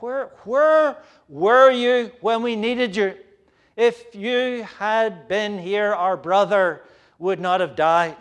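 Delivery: grieving sisters rebuking Jesus; rebuking plea. Acted. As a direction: let the grief turn to accusation. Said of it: "the implicit rebuke".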